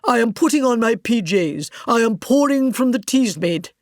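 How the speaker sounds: mocking a radio play; lightly voiced plodding radio drama; mock radio actor. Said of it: "Anyone can say".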